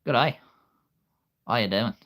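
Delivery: cheery Australian greeting; Australian accent. Shown as a demonstration. Trying out accents for listeners, Australian accent. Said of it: "my Australian accent".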